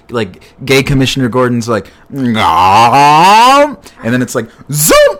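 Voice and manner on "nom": loud, higher cartoon munching noise; a hammed-up bite sound; big cartoon nom, higher and louder; mock chomp. Flash chomps the burger.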